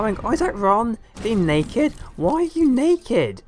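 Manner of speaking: high-pitched mock-scandalized outburst; mock outrage. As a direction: scold the naked characters in exaggerated disbelief. Mock outrage at naked game characters.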